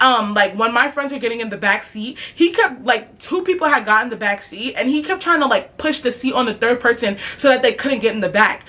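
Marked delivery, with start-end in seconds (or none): none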